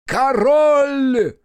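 high, loud, sustained cry; full acclaiming yell, very high; shout. Acted. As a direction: shout it out and hold the note. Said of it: "кричит".

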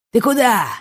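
put-on sharp drunken shout. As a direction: call out after her loudly. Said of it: "закричал он".

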